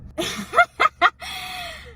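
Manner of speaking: a burst of high laughter. Real, not acted. Laughing at being underdressed at the party, high laugh. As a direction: laugh at her own embarrassment.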